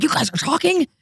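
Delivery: high-pitched, rapid mock tirade; throwing a fit. Probation officer catching two inmates talking, high-pitched mock fit. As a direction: imitate an outraged officer scolding offenders. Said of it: "she threw a fit".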